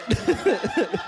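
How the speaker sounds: loud, high, through laughter; loud laughing admission; laughing loudly. Real, not acted. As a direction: blurt it while cracking up. Laughing at own slip.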